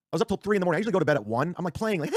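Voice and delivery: ordinary delivery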